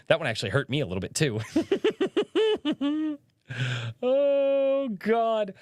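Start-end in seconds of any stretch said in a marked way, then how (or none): 1.4-3.2 high laugh. Real, not acted. Laughing at wife's taco joke.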